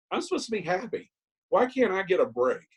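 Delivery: high pleading voice; mock self-pity whine. Mocking his old self-pity, whining up high. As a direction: whine it high like a hard-done-by child.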